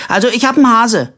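high, loud, eager blurt; excited childlike announcement. A narrator's reading. Simpel telling a stranger about his rabbit, excited.

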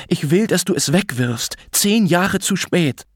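high, insistent angry demand; mother's heated ultimatum; hissed anger. A narrator's reading. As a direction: lay down the demand, stretching the final words. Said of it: "fauchte Mutter".

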